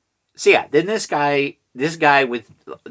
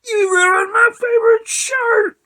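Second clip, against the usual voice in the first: very high, loud unhinged mock scream; Nicolas Cage; 8 dB louder than usual. Shot man looking down, mock unhinged Mandy scream. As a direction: shriek it like an over-the-top movie meltdown. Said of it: "Like, uh, Mandy with, uh, Nicolas Cage".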